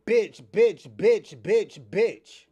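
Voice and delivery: mocking loud taunt. Real, not acted.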